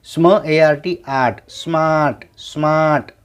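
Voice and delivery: usual speaking voice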